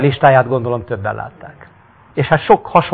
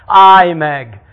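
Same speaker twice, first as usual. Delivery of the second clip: loud, higher cry; crippled man shouting to Jesus; pleading call; 5 semitones higher, 9 dB louder than usual. Acted. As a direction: call out desperately. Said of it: "sírt a sok nyomorék".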